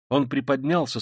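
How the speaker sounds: ordinary delivery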